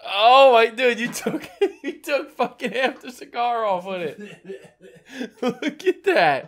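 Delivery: cracking up mid-sentence; high laughing disbelief. Real, not acted. Friend bit off half cigar.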